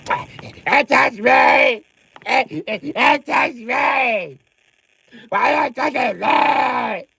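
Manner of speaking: very high, slow, drawn-out shout; over-the-top woman shrieking; hammed-up screech. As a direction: screech it out in mock outrage. Acted quarrel, Eve rebuffing Adam.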